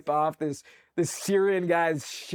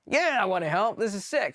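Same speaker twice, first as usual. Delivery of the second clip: eager yes; 7 semitones higher than usual. Retold or imitated. Eager volunteering quote.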